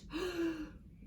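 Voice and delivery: sharp mock gasp; dramatic gasp. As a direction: gasp for the kids. Reader gasps at danger.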